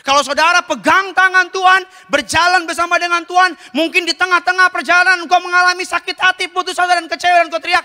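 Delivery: high, emphatic preacher's voice; preaching. Acted. Preaches at raised pitch. (khotbah berapi-api dengan nada tinggi)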